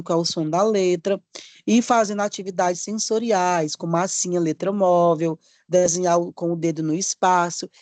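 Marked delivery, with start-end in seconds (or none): none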